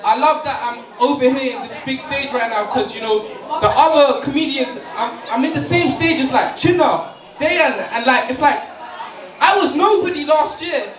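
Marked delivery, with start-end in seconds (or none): none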